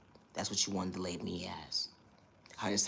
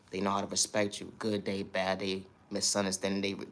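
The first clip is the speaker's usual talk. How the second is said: ordinary talk, nothing marked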